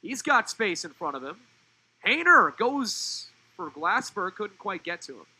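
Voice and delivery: loud call building on the player's name, then easing; excited play-by-play. Real, not acted. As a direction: ride the attack up, then let it drop. Calls a live attacking chance.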